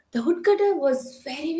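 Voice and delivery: plain reading voice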